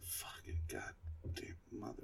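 slow, whispered swearing; muttered cursing. Real, not acted. Fan fuming over Star Trek Beyond, whispered cursing. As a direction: curse under your breath, drawn out.

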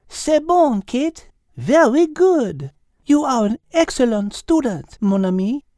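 put-on high French-accented teacher voice; french chef. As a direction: teach it like a cheerful French cook. Liz's lines.

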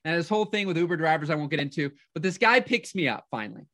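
usual speaking voice

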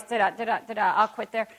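ordinary delivery

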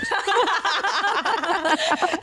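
high bursting laugh; real laugh after the accent bit. Real, not acted. Laughs at own impression.

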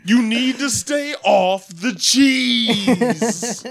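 Stephen A. Smith impression. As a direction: preach it like a TV sports debater. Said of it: "Stephen A. Smith talks you through a poop".

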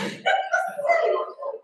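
breathy high laugh at herself; real embarrassed giggle; high helpless laugh. Real, not acted. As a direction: laugh it out, can't stop. Laughing at own story.

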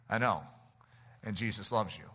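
low, soft, unhurried reassurance; quiet, low-pitched tender reply; gentle reply. Retold or imitated. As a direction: answer softly and kindly, voice dropped low. Missionary answers Muslims gently.

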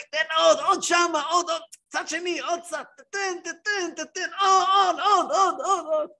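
hammed-up yelling at the bath attendant to keep whipping. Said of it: "ואתה צועק".

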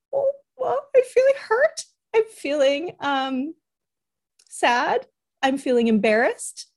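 high-pitched, flustered admission; hesitant confession. Retold or imitated. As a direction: squeak out each feeling, searching for it. Retells haltingly naming feelings to son.

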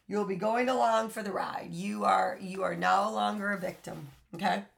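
ordinary talk

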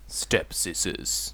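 slow, hushed whisper; whispered title echo. Pretend. Host echoing the short film's title, slow whisper. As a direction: whisper it like an eerie title sting. Echoes the title as a sound effect.

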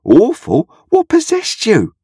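very high-pitched, appalled questioning; shocked cry. A narrator's reading. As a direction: demand an answer, voice shooting up and stretched out. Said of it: "cried Joe".

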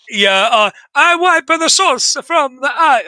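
slow, high hammed-up Italian accent; cringey Italian waiter impression; embarrassed Italian caricature, drawn out; mock Italian accent. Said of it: "Oh, Jesus Christ. I'm gonna stop doing it".

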